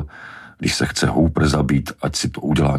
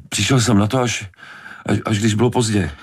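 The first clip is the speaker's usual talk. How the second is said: higher, faltering, stammered excuse; desperate; 5 semitones higher than usual. A narrator's reading. Hooper keeping up with Brody's questions, desperate. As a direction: scramble for an explanation, stumbling. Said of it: "Zoufale se snažil udržet tempo".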